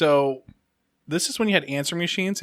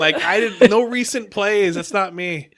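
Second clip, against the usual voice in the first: raised, insistent retold denial; higher, emphatic self-defence; defensive protest; 7 semitones higher, 7 dB louder than usual. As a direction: plead innocence with mock indignation. Acting his own protest of innocence.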